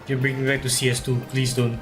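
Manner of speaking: normal talking voice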